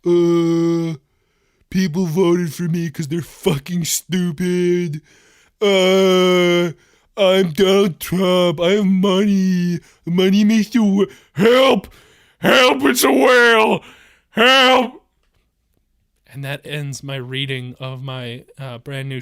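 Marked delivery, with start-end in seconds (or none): 0.0-11.1 slow, drawn-out caricature voice; Donald Trump. Acted. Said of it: "That was a pretty good impression". 11.3-15.0 loud, high drawn-out calls for help; mock panicked cry. Trump character cries for help.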